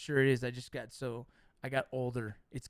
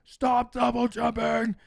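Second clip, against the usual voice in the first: exasperated parent yell, louder and higher; yelling at the kids; 6 semitones higher, 5 dB louder, slower than usual. Retold or imitated. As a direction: shout it like a fed-up dad at the trampoline. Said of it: "I'm like".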